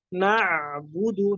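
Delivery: "na'budu" slowed, the ain sustained and flowing. Shown as a demonstration. Teacher demonstrates the ain, slow flowing "na'budu". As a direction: let the ain run on in "na'budu".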